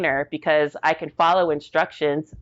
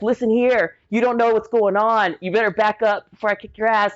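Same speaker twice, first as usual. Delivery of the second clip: higher-pitched mock threat; acted angry warning; 5 semitones higher than usual. Recruit to mocking bunkmate, retold angry warning. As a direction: act out warning her to back off.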